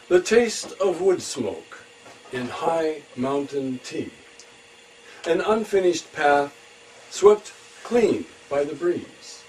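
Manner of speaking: high, loud, slow phrases with pauses; poetry reading. Acted. Poet reading a travel poem to listeners, measured poetry reading. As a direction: declaim the poem image by image.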